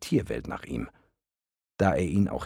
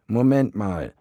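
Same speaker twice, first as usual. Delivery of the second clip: higher, louder grumpy worm; 6 semitones higher, 6 dB louder than usual. Acted. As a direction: snap it out, grumbling. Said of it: "sagte er mürrisch".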